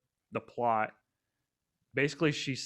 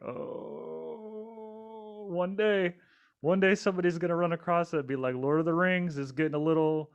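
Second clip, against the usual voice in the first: mock lament; 8 semitones higher, 3 dB louder than usual. Imagining the day someone calls out the movie, mock lament. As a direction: jump well above your usual pitch, playfully dreading it.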